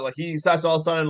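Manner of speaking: ordinary talk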